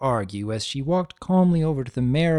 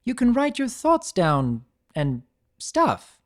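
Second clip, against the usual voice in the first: flustered; 10 semitones higher, slower than usual. A narrator's reading.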